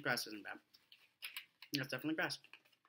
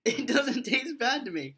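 laughing; 10 semitones higher, 7 dB louder, slower than usual. Real, not acted. Laughing insistence.

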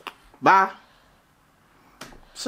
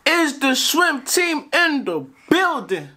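announcer call; 8 semitones higher, slower than usual. Acted. Hype intro call.

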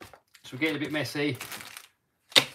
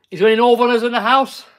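put-on northern accent; 8 semitones higher, 7 dB louder than usual. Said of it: "How's my northern?".

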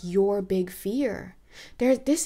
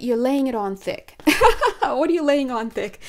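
talking through real laughter; laughing; 2 semitones higher, 7 dB louder than usual. Real, not acted. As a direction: chuckle the words out. Laughing at the cards.

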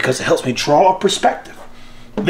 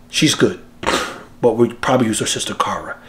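ordinary talk, nothing marked

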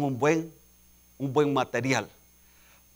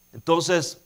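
usual speaking voice

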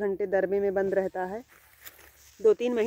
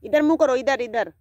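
higher and louder, a quick command; calling out; 4 semitones higher, 5 dB louder than usual. Real, not acted. Owner filming pet, calling it to turn, loud call. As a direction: call sharply so it turns its head. Asking pet to face camera.

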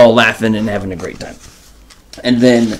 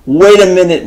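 higher, louder wait a minute; startled Knox; 6 semitones higher, 6 dB louder than usual. Retold or imitated. Knox stopping Fox mid-rhyme, loud and startled. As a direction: call a halt, jolted. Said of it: "Mister Knox is like".

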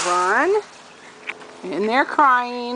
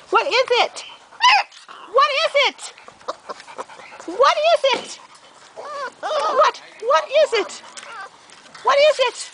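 repeated high pet-voice asks; puppy talk; 14 semitones higher than usual. Real, not acted. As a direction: coo what is it to a puppy. Asking puppy in baby talk.

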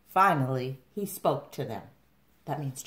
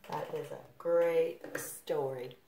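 plain reading voice

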